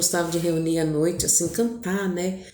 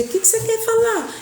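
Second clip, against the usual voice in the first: put-on much higher, eager kid's question; curious child. Children pester their father about his news, childlike. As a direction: play an impatient little kid. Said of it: "Sabe coisa de criança".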